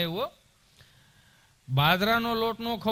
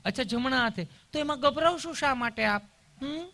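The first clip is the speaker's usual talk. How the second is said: higher-pitched, cooing nurse voice; sweetly caring nurse; 7 semitones higher than usual. Acted. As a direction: mimic an over-sweet nurse. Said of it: "તમે કેટલું પ્રેમથી પૂછો છો".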